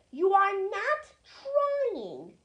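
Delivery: slow, stern scolding; a hint of a stern driver; severe. A narrator's reading. Driver scolds Gordon for not trying. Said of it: "talking to him severely".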